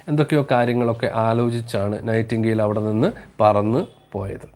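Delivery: usual speaking voice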